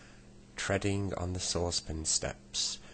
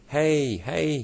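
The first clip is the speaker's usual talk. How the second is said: loud, high yell of greeting; 10 semitones higher, 9 dB louder than usual. A narrator's reading. Saucepan man yelling down to his old friend, loud and high. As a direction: call out with both lungs. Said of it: "he yelled".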